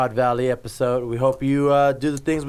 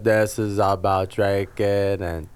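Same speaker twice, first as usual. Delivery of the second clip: slow, low drawling mimicry; mock the speaker with a dragged-out drawl; Forrest Gump; 6 semitones lower, slower than usual. Co-host mocking the show intro, slow dopey drawl. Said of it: "You sound like Forrest Gump".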